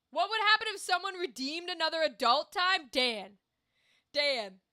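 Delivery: exasperated, teasing plea; playful scolding. Real, not acted. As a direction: tell them off with punched words. Mock scolding Dan over channel redeem.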